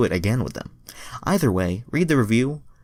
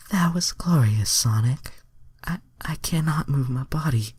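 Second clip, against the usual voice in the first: higher whispery, spent voice; breathless Starfire; 9 semitones higher than usual. A narrator's reading. Narrator voicing the heroine after, higher and whispery. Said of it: "Starfire said, smiling".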